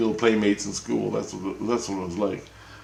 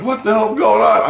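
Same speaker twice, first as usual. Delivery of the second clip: high, loud startled outburst; 14 semitones higher, 9 dB louder than usual. Retold or imitated. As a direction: blurt it out in shock. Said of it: "I'm like".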